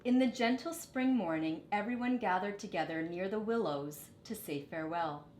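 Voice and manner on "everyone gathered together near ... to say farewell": usual speaking voice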